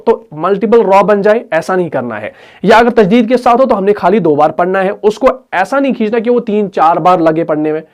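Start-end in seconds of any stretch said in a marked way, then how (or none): none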